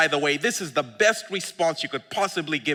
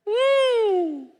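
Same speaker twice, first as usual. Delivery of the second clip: ordinary delivery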